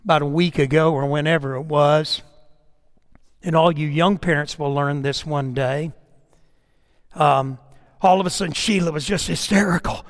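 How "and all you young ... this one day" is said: usual speaking voice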